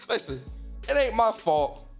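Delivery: ordinary talk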